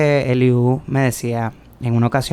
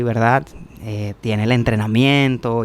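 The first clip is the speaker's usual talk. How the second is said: usual speaking voice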